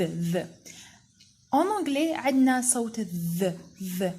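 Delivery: normal talking voice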